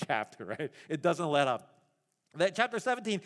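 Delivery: usual speaking voice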